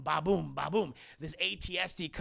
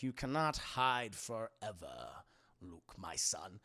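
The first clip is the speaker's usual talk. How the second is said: slow, lower menacing taunt; lightly done Vader; 8 semitones lower, 5 dB quieter, slower than usual. Retold or imitated. Streamer voices Vader hunting Luke, slow low menace. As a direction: menace him slowly and low.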